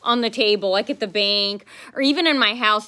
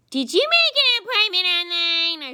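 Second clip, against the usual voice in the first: mocking whiny clerk; 10 semitones higher, 4 dB louder than usual. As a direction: drag it out higher and louder to ridicule her. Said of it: "and she goes".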